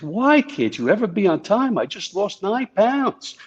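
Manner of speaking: high, slow mock-indignant complaint; Gary's deadpan complaint. Agent teasing the late president, high mock-indignant whine. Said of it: "and Gary says".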